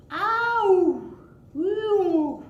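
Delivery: loud, high foghorn and whistle snores; playful snoring sounds; snore noises. Acted. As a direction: imitate a foghorn then a whistle.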